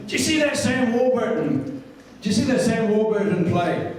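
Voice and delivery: chirpy mimicry of a mate calling; imitating Davey. Voicing Davey.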